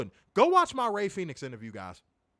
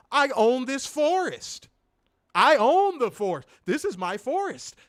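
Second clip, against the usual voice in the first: loud, higher, insistent know-it-all; hammed-up indignant forest owner; stubborn mock shout; 3 semitones higher, 4 dB louder than usual. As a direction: bellow like an old man who owns the forest. Acted stubborn forest owner arguing.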